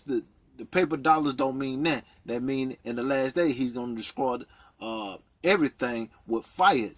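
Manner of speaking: usual speaking voice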